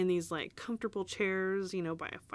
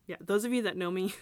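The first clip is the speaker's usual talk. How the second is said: ordinary talk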